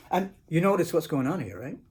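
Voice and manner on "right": plain reading voice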